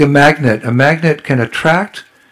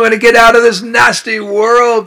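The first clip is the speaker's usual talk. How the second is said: pleading prayer; 8 semitones higher than usual. Acted. Preacher voices devotee's prayer.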